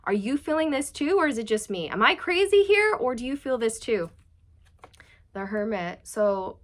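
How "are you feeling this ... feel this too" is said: anxious pleading. Retold or imitated. Masculine asks the feminine, anxious.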